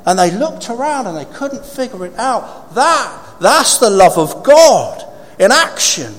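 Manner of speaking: loud, high, slow proclamation; full-force preaching. Real, not acted. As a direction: preach it big, with long pauses between phrases.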